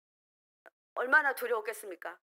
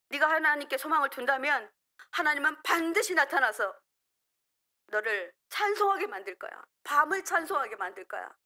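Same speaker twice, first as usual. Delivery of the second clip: rebuking one's own soul; 2 semitones higher, 5 dB louder than usual. Shown as a demonstration. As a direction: scold your own soul into hope. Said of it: "나에게 꾸짖으시기 바랍니다". (자기 영혼을 큰 소리로 꾸짖듯)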